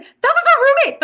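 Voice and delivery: high, loud and quick, delighted surprise; excited realization. Retold or imitated. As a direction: blurt it out like you just recognized her. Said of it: "I was like".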